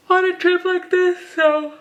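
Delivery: talking through a big yawn; words stretched by a yawn. Real, not acted. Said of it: "sorry, I'm yawning again".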